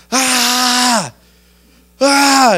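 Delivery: long, high, loud shriek; mock horrified scream. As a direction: scream in comically exaggerated shock. Horrified reaction in retold story.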